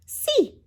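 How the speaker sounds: high bright Spanish "Sí". A narrator's reading. Brickmaker answering the javelina with a Spanish "Sí". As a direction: say "Sí" as a quick high Spanish yes. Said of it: "answered the man".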